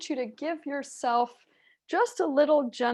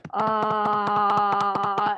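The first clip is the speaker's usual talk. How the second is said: saying ahh. Shown as a demonstration. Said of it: "make an ah sound".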